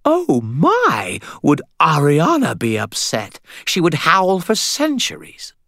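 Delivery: put-on a high, eerie, sing-song vampire girl; high childlike vampire woman. A narrator's reading. Vampire Esmeralda teasing the wizard in a high, eerie voice. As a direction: lilt it up high like a strange little vampire.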